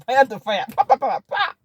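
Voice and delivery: loud, high volley of popping syllables; firing back. Acted. Wife showing how she answered husband back, loud rapid-fire volley. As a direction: spit out "pa" like gunfire.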